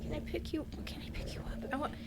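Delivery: soft unvoiced whisper; gentle whisper. Real, not acted. Whispering to game baby.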